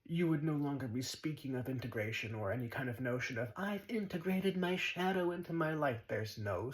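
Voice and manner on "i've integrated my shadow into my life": higher, mock-earnest boast; lightly mocking spiritual boast; mocking seeker. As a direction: parrot a smug seeker, lightly.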